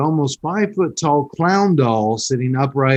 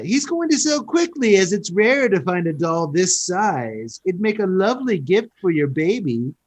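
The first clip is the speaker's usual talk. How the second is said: fake British accent; 6 semitones higher than usual. Shopkeeper pitching a clown doll as baby gift, fake British accent. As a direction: sell it in a hammy British accent. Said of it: "I don't do a British accent".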